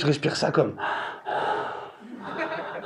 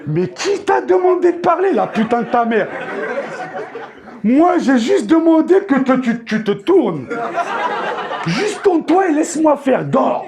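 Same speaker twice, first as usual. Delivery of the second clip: mock indignant rant at the wife; exasperated retort; 6 semitones higher than usual. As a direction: snap back at her in mock fury.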